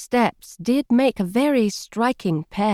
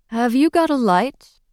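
put-on laid-back American accent, lightly amused; dry amused American accent; 2 semitones higher than usual. A narrator's reading. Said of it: "His voice was dry, American, amused".

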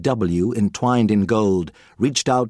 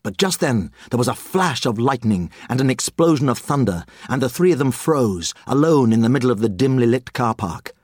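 ordinary delivery, nothing marked

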